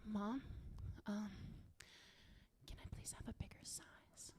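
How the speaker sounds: timid, barely audible whisper; embarrassed kid whispering to mom. Acted. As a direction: whisper it haltingly through a cracked door. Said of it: "Mom, I was whispering to you".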